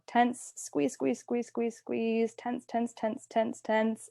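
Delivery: quick repeated cue, hushed but insistent; urging tension. Shown as a demonstration. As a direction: coach them to hold everything tight. Coaching muscle tensing before release.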